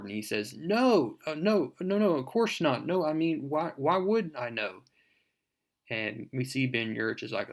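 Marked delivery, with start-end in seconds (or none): 0.6-4.8 flustered, higher-pitched string of denials; caught off guard. Retold or imitated. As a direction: protest too much, stumbling over quick excuses. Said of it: "catches Peter Parker off guard".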